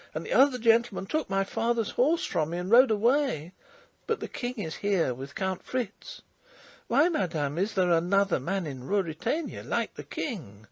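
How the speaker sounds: put-on high, eager young peasant girl; a breathless country girl bursting with news; excited farm girl. Girl explains the second king.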